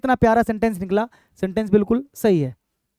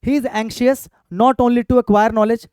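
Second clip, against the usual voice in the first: very slow word-by-word reading; slow board-reading voice; slow dictation; much slower than usual. Shown as a demonstration. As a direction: read the example out slowly for the class. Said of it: "इन पे आ जाओ एक बार".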